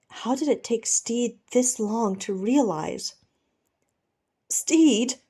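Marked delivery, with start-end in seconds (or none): 4.5-5.2 higher cry of his name, cracking; voice breaking. Acted. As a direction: let the name crack in anguish. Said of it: "Ed's voice breaks".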